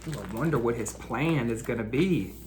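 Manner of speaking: ordinary delivery, nothing marked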